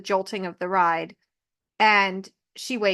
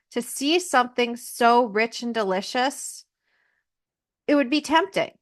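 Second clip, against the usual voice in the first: normal talking voice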